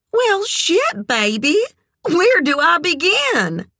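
very high, relishing drawn-up voice; brassy friend. A narrator's reading. Red delighted by Kit's question about sex, brassy. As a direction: pitch it way up with showy delight.

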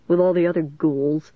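usual speaking voice

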